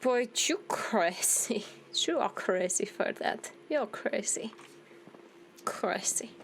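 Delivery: usual speaking voice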